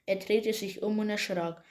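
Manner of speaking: usual speaking voice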